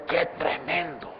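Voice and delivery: soft, slow, hushed amazement; awed exclamation. Pretend. Believer finding treasures in the word, hushed awe. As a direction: breathe it out in quiet wonder. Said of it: "que usted dice".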